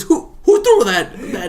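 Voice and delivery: high, loud squeaky landlady cracking up; mock landlady, laughing. Friend voicing the landlady asking who threw it, high and laughing. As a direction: play the landlady asking through giggles. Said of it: "She says".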